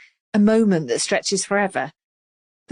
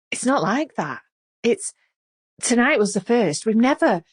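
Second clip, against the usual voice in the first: halting, higher and tearful; jerky, tearful; 5 semitones higher than usual. A narrator's reading. Vicky denying to Melanie, halting and tearful. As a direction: stammer it out, close to tears. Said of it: "Her words are all jerky".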